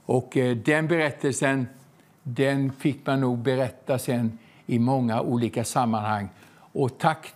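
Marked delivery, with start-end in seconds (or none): none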